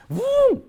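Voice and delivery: loud high burst for the car taking off; mock boom; imitated boom. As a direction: sound effect of the car launching.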